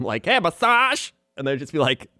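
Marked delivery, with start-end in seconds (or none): 0.2-1.1 much higher, louder "Hey, massage!"; yelling vendor. Retold or imitated. Imitating the massage lady yelling at shoppers, high call. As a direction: call across the mall to passersby. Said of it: "yell at them".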